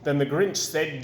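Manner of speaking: ordinary delivery, nothing marked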